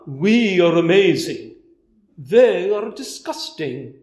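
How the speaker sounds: mock smug. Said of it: "they're not coming from".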